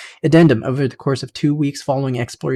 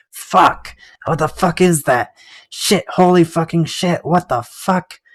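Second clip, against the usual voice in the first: startled, higher, panicked cursing; panicked swearing; 4 semitones higher, slower than usual. Acted. Prisoner sees a faceless head in the stairwell, panicked cursing. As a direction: blurt it out in sudden fright.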